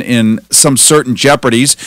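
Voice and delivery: normal talking voice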